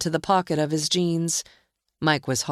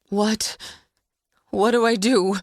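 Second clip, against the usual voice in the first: panicked; 5 semitones higher than usual. A narrator's reading. Sheridan panicking.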